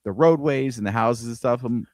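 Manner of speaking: ordinary delivery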